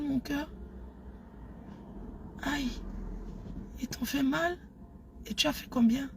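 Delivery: hushed, soft pretend phone intimacy; intime au téléphone. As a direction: murmur close into the phone for a lover. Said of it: "je fais comme si je niaise avec quelqu'un". (voix feutrée d'amoureuse au téléphone, jouée)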